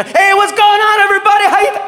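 high, big showman's hello; hyped stage greeting. Retold or imitated. Comic greeting crowd before freezing, high excited call. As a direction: burst onstage greeting, cut off short.

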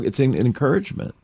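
normal talking voice

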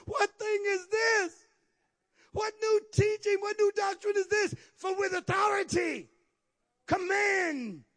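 high, astonished crowd questioning; amazed. Acted. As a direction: cry out in amazement, high and drawn. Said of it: "they were all amazed".

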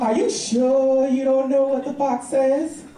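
niece. Acted. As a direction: draw the question out like a doubtful child. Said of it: "And she goes".

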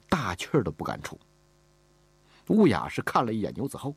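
usual speaking voice

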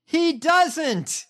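high, slow heckling call; mock heckle. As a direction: call back at the speaker in a high voice. Heckling the played speech.

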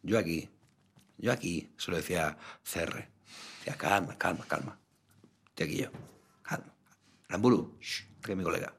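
soft, hushed mock bodyguard calming the room. Cohost pretends to calm everyone for his friend, hushed and soft. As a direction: settle them down in a hushed voice.

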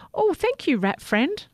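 high, grateful call. A narrator's reading. Toothbrush calling thanks to the rat as he drifts away. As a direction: call out happily while floating off. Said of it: "Sammy called".

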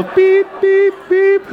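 high electronic beeps; imitated beeping. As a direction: mimic a machine with piercing high beeps. Imitating check-in machine noise.

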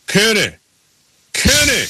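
calling Kenny from a distance. Retold or imitated. Calling out across the track.

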